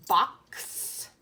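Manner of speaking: box with the x ending hissed as ks; ending x sound. Shown as a demonstration. Teacher shows the ks ending to children, hissed. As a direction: stretch the ks at the end of box. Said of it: "Hear that?".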